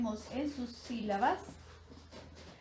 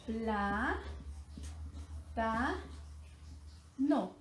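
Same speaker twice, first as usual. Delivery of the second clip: "Pla, ta, no" broken into separate syllables with long pauses; 3 semitones lower, 7 dB quieter, much slower than usual. Shown as a demonstration. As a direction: stretch "Pla" and "ta" with a rising end, then drop on "no". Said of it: "Vamos a descomponerlas en sílabas".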